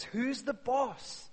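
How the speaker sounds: slow, drawn out "who's the boss"; preacher asking slowly; slow rhetorical question. Real, not acted. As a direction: let it hang slowly. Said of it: "rhetorical question".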